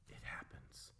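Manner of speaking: hushed whisper, meaningful. Real, not acted. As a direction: whisper it like a secret warning. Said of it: "I want you to really understand".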